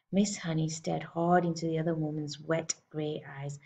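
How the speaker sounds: usual speaking voice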